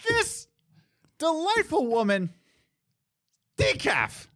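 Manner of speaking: high, prim put-on decaf; hammed-up customer saying decaf; mock lady ordering. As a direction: pitch way up and chirp it. Sarcasm then imitating order.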